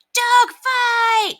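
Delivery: put-on high, loud boy's yell. A narrator's reading. Boy announces a fight to the neighbors, yelling high. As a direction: yell the news to the whole street. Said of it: "a boy yelled".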